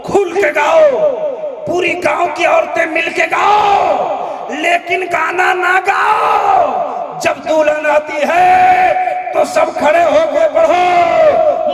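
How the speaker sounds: fervent exhortation. Real, not acted. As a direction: thunder it to the crowd. Preacher exhorts the women. (माँ-बहनों से ऊँची, ज़ोरदार पुकार)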